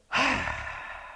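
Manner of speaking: long hissing angry exhale; voiceless snake hiss of anger; angry breath. Shown as a demonstration. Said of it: "angry breaths".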